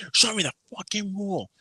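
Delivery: high-pitched mock yell, held down in volume. Imitating the customer demanding the rule, mock yelling. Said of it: "is yelling right in his".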